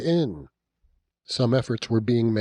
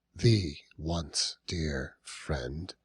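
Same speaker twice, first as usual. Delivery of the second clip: even softer, lower and very slow; hushed, aching repeat; 8 semitones lower, 6 dB quieter, much slower than usual. A narrator's reading. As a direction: linger on it as if it hurts. Narrator repeats, softer and slower.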